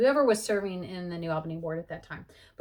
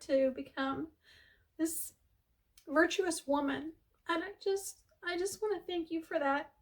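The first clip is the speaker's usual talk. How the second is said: higher, softer voice on the verge of tears; holding back tears; 8 semitones higher, 4 dB quieter than usual. Real, not acted. Thanking late missionary Tyler, voice near tears. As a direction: let the voice catch as the thanks come out. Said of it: "I'm gonna cry for sure".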